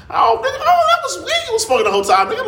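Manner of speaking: loud, very high comic astonishment; retold realization. Nephew realizing uncle smoked weed, high loud mock shock. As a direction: act out the shock of finally getting it.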